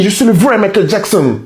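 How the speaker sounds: normal talking voice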